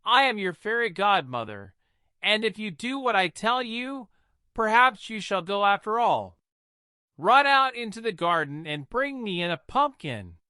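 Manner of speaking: a hint of a magical old woman giving orders; fairy godmother. A narrator's reading. Godmother sends Cinderella for a pumpkin, lightly voiced.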